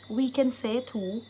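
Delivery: ordinary delivery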